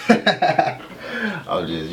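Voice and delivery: bursting into high laughter; giggling, high pitched and loud; laughing. Real, not acted.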